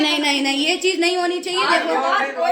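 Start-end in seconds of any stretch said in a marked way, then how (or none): 1.6-2.5 laughing look. Real, not acted. Pointing out Pari, laughing.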